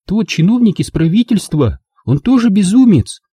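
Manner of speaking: put-on high, bitter girl's voice; bitter Angela. A narrator's reading. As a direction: complain bitterly about him. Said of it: "с горечью ответила она". (Анжела с горечью, высоким голосом)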